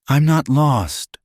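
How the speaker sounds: tired but firm, a touch of the South; weary southern widow standing her ground; slight southern accent. A narrator's reading. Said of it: "Her voice carried a slight southern accent".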